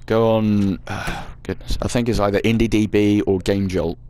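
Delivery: normal talking voice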